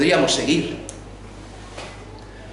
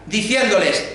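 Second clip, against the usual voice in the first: ordinary delivery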